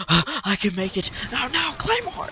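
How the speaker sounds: imitating a panicked player running into a claymore; mock panic. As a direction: squeak in panic as the claymore blows. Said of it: "don't just".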